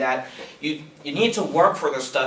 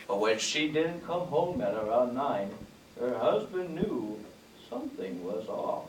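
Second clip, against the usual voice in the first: soft, slow storybook crime narrator fading quieter; Keith Morrison impression; 5 dB quieter than usual. Comedian imitating the Dateline host telling crime like a children's show, soft and slow. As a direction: read the crime like a bedtime story, sinking softer. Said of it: "tells stories like a children's show".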